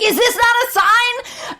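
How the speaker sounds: very high, hammed-up cry; screechy-high imitation of an indignant wife; mock exasperated wife. Retold wife challenges husband after the earthquake, mock exasperation.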